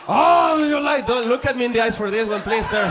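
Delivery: loud, high, strained plea; hammed-up shout from an overwhelmed lover; frantic shouting. Short man pleads with his tall lover, shouting frantically. Acted frantic bedroom scene.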